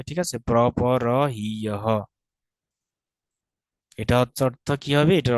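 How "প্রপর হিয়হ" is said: প্রপর হিয়হ slowly, pra-pa-ra hee-ya-ha; slow teacher's breakdown of প্রপর হিয়হ; syllable by syllable. Shown as a demonstration. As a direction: sound out প্রপর হিয়হ in separate, drawn syllables. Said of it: "আগের মতো প্রপর হিয় হয়ে যাবে".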